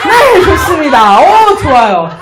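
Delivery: loud, drawn-out approval over laughter; big, amused exclamation; loud praise. Real, not acted. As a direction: cheer the laughing group on.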